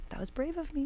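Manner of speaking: tiny, high, hushed voice; soft, high-pitched little pat on the back; small self-praise. Retold or imitated. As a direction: quietly marvel at yourself. Voices a small private self-congratulation.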